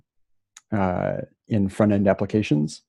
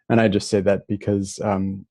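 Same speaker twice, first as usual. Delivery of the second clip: normal talking voice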